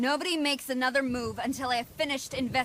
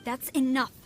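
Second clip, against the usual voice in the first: sharp rebuke; 4 semitones higher, 5 dB louder than usual. Acted. Commander cuts off excuses.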